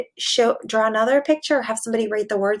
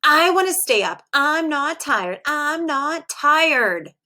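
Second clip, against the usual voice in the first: slow, sulky higher whine, each word dragged out; grouchy whine; 5 semitones higher, slower than usual. Acted. Percy refusing to go to bed, slow grouchy whine. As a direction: play the cranky kid refusing bed. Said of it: "That's not a very nice tone".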